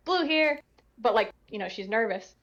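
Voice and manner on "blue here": quoting Blue. Retold or imitated. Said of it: "she says".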